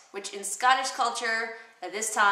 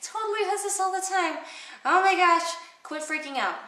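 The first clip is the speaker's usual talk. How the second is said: put-on very high, louder, frantic valley-girl brushing it off; exasperated Lady Macbeth; 11 semitones higher, 4 dB louder than usual. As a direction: squeal it out to cover for him. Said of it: "She's like".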